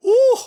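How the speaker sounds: high, loud hurt cry; pained ooh. Real, not acted. Her knuckle still hurting, a loud pained ooh. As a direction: wince out loud.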